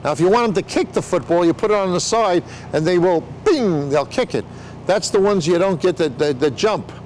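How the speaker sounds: ordinary talk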